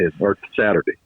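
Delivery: ordinary delivery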